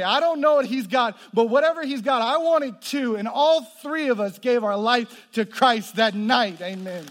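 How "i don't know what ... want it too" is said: friends' eager plea. Retold or imitated. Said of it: "they stand up and they say".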